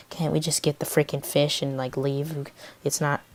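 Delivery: low, very soft inner thought; hushed private grumble; thinking to self. Retold or imitated. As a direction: murmur it privately, as if in your head. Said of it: "thinking in my head".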